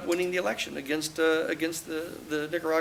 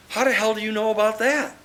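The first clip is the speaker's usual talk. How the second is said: higher and louder retold disbelief; a lawyer's alarmed, raised challenge; stunned demand; 6 semitones higher, 6 dB louder than usual. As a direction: demand to know, still rattled.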